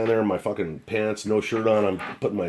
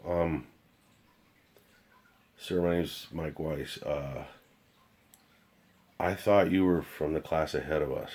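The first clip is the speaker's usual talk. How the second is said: deflated apology; 3 semitones lower, 5 dB quieter than usual. Retold or imitated. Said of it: "deflated right in front of this guy".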